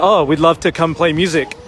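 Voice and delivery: usual speaking voice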